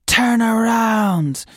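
slow drawn-out spooky call; ghostly voice. Pretend. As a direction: stretch it like a ghost. Quiz option voiced.

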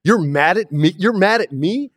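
high, mock-outraged protest; indignant retort. To the director after the dinghy stunt, indignant and high. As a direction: push it up into disbelieving outrage.

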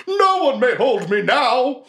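high, drawn-out defiant cry; defiant villain. Acted. Forsaken breaking free of a grasp, defiant villain voice. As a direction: declaim it slowly, far above your own pitch. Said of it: "The hand goes up to grasp and he says".

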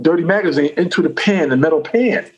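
ordinary talk

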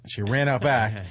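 normal talking voice

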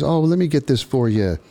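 high pitched charming man; charming stranger. Retold or imitated. Stranger insists on helping, high and charming.

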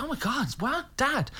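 high-pitched baffled exclamation; mock disbelief. Son reacts to Dad during the show, baffled. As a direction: squeal it, exasperated at Dad.

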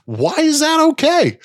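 a high, incredulous why. Real, not acted. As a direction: ask it in high disbelief. Indignant rhetorical question.

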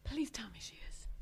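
whispered; whispered plea. A narrator's reading. Mother begging her son for good news, whispered. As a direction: breathe the plea out. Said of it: "mother pleading".

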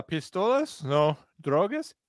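mock Spanish-accented question and answer. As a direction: act out both sides with a Spanish accent. Imitates a Spanish-accented questioning exchange.

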